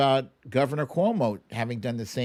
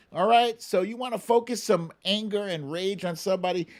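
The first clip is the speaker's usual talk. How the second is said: ordinary talk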